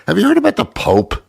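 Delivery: high, fast mock Pope voice; a jittery, high-pitched Pope; squeaky high comic Pope; Pope impression. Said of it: "that's my impression of, uh, the Pope".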